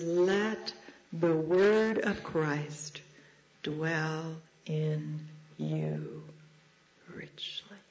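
very slow, word by word, fading at the end; solemn recitation. Real, not acted. Preacher reciting a verse to friends, solemnly slow. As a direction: let each word stand alone, ending hushed.

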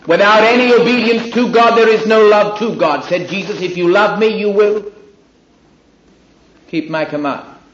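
loud, ringing proclaiming of the verse; forceful quotation. Acted. Preacher proclaiming the words of Jesus to the congregation, loud and ringing.

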